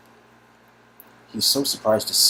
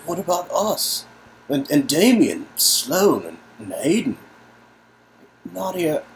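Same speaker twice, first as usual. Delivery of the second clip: higher, louder plea; pleading; 7 semitones higher, 6 dB louder, slower than usual. Acted. To cousin about friends left behind, pleading. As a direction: beg her to think of the others.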